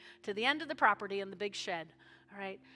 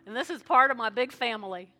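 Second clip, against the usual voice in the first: led prayer; 3 dB louder than usual. Acted. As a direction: lead the congregation in prayer line by line. Leads a sinner's prayer.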